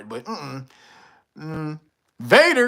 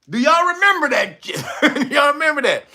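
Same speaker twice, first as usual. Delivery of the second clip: laughing; 6 semitones higher than usual. Real, not acted. Laughing while asking chat.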